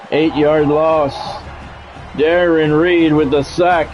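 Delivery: normal talking voice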